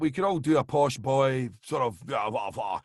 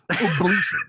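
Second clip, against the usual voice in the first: Lenny Beige ablution; 4 semitones higher, 7 dB louder than usual. Shown as a demonstration. Friend demos the word for the host, showbiz style. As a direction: croon Ablution like a cheesy lounge singer. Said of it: "Nobody can say ablution like Lenny Beige".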